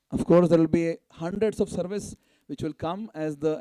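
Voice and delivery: usual speaking voice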